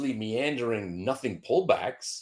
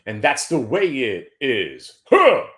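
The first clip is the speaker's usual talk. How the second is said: mock newsman sign-off; 2 semitones higher, 6 dB louder, slower than usual. Comic sign-off flourish.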